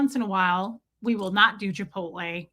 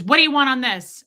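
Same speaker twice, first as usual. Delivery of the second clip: loud, higher mock server demand; bot-like server; 3 semitones higher, 7 dB louder than usual. Server to customer at the counter, imitated bot-like demand. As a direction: imitate a curt order-taker. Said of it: "He just was like a bot".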